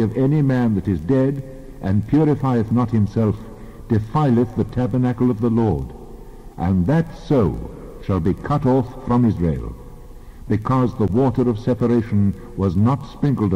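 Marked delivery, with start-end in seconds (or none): none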